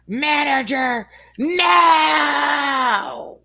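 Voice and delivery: high, loud, stretched-out mock scream; screaming customer. Retelling the customer refusing to de-escalate, screaming. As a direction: holler each word long like an irate caller.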